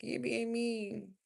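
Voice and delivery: high, slow mock whine of a complaint; imitating complainers. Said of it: "y'all be like".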